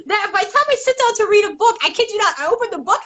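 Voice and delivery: normal talking voice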